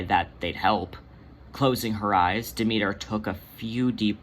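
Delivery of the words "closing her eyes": ordinary delivery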